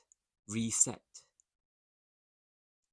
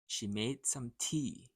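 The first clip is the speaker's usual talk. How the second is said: "She made some tea" said a little quicker and lighter; a little faster; 3 dB quieter than usual. Shown as a demonstration. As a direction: pick up the pace a bit. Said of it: "then a little faster".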